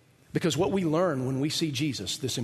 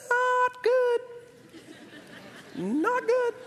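much higher, squeaky "Not good"; high mock Sarah; much slower than usual. Preacher voicing Sarah's reaction to the child, high mock squeal. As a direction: mimic Sarah's disgusted squeal. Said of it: "how she responded to that".

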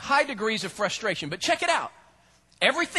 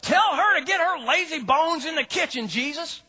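higher, insistent ordering; hammed-up angry sister; mock bossy demand; 7 semitones higher than usual. Martha ordering Jesus to send her sister to the kitchen. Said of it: "As Martha is going".

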